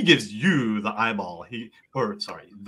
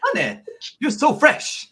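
very high, delighted scolding; tickled flirty bartender; 12 semitones higher than usual. Acted. Bartender reacting to a wink, high and flirty. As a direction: playfully chide the flirt in a high voice.

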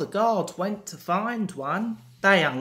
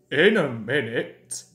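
lightly voiced big engine, lower; a hint of pompous engine, pitched down; Gordon's scheming thought; 5 semitones lower than usual. A narrator's reading. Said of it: "he thought".

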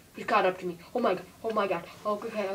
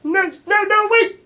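high, very loud protest; panicked shout; 10 semitones higher, 16 dB louder, much slower than usual. Pretend. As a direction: yell in play panic as you fall. Character being dropped in play.

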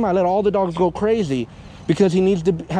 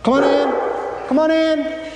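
high sing-song call, +10 semitones; high coaxing call to the dog; 8 semitones higher, slower than usual. Real, not acted. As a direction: coax the dog in with a high, lilting voice. Calling dog inside.

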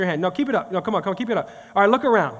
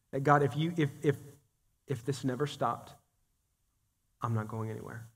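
hushed prayer; 7 semitones lower, 9 dB quieter than usual. Acted. Voices a surrendered prayer.

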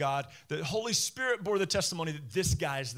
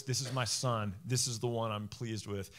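lower, softer solemn voice from heaven; a hushed, lowered voice of God speaking over the water; quiet voice from heaven, pitched lower; 7 semitones lower, 5 dB quieter than usual. Retold or imitated. Voice from heaven at baptism.

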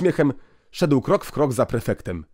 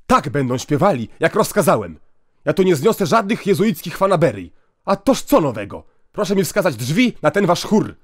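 loud, higher, raging orders; furious shout; 6 semitones higher, 5 dB louder than usual. Acted. Inspector ordering the priest about, a furious shout. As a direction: bellow your commands at him.

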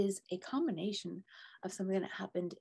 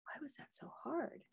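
half-whispered wonder; 8 semitones higher, 6 dB quieter than usual. Retold or imitated. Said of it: "I'm like".

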